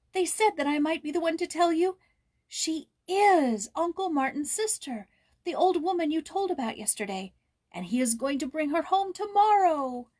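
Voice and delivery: high and loud, eager tumbling news; a boy who can't wait to share it; excited boy. A narrator's reading. Jules telling Joyce the big news, eager and high. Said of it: "he cried".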